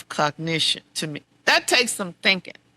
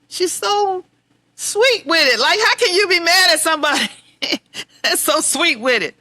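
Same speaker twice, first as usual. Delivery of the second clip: normal talking voice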